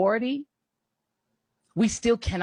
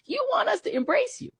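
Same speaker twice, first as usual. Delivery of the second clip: much higher, incredulous mocking lift; mocking disbelief. Real, not acted. Host repeating the charge to right-wingers, high and mocking. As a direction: throw it back at them in mock disbelief.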